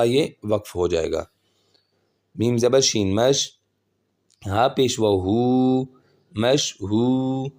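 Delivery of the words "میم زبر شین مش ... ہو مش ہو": slow, measured syllable-by-syllable drill; spelling-drill recitation. Shown as a demonstration. Quran teacher spelling words for learners, measured recitation. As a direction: draw out the long syllables evenly.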